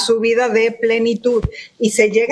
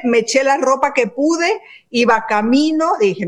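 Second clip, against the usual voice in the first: usual speaking voice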